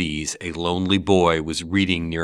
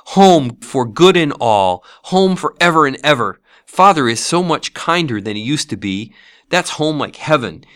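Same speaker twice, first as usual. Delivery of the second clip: put-on higher-pitched little girl voice, overjoyed; brimful of glee; 6 semitones higher, 3 dB louder than usual. A narrator's reading. Little sister telling her brother of home, gleeful. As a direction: pour out the good news like a happy child. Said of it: "said the child, brimful of glee".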